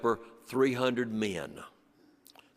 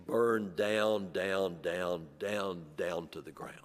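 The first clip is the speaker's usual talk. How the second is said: each down softer and lower until nearly gone; fading down; 7 semitones lower, 6 dB quieter, slower than usual. Real, not acted. Teller watches the furniture plant burn away, fading to a hush. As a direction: let the words sink away like the ruins. Factory burning, repeated down.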